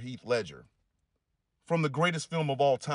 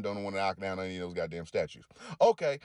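usual speaking voice

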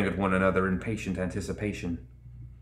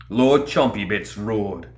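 usual speaking voice

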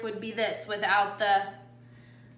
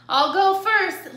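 raised, louder, drawn-out brave offer; 8 semitones higher, 9 dB louder than usual. A narrator's reading. Lily volunteers to go first, bravely. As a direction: pipe up bravely, slow and loud. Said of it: "Lily volunteered bravely".

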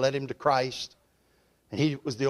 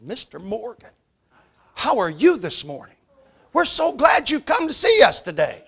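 climbing into a higher gushing welcome; fawning bank lady; 7 semitones higher, 5 dB louder than usual. Retold or imitated. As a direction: gush over the important client. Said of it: "That woman ... saw Brother Morgan".

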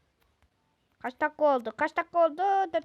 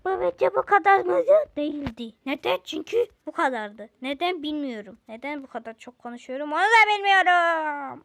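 loud ramble; 10 dB louder than usual. Real, not acted. Rambling loudly to camera.

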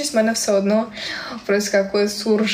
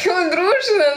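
laughing surprise; 8 semitones higher than usual. Real, not acted. Reacting to a marriage question.

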